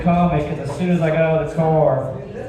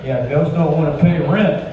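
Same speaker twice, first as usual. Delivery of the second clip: normal talking voice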